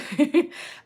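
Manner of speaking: a short, high, delighted laugh. Real, not acted. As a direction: burst into a quick laugh. Laughs at a viewer's comment.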